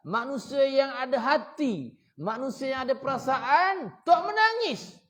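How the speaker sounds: loud, high, impassioned sermon voice; preaching at full force. Acted. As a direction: thunder it at the congregation, building to a peak on the last words.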